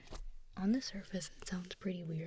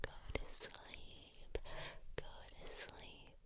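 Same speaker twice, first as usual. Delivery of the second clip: very slow, soft lulling whisper; soothing lullaby whisper; 9 dB quieter, much slower than usual. Pretend. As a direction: coax them gently to sleep. Lulling listener to sleep.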